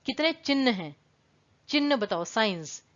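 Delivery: ordinary delivery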